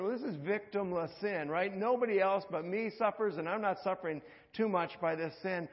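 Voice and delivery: usual speaking voice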